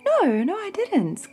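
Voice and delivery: put-on high, grumbling old man voice; lightly voiced weary complaint; complaining old priest. A narrator's reading. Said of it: "complained Uncle Eli".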